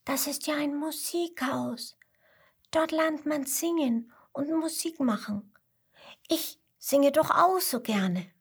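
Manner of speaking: put-on high, light child's voice; enchanted youngster discovering something lovely; little koala marvelling. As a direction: think aloud in high-pitched wonder. Little koala thinks in wonder.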